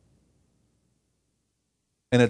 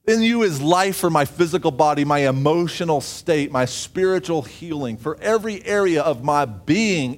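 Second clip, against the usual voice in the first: raised, louder preaching voice, pushed high; fervent preaching; 6 semitones higher, 5 dB louder than usual. Acted. Preacher proclaiming to the congregation, fervent and raised. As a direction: preach it out with fire to the room.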